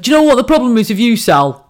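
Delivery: imitating her Northern husband having a go; Northern bloke voice, quick and loud; Northern husband impression. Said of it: "This is how he talks. He's Northern".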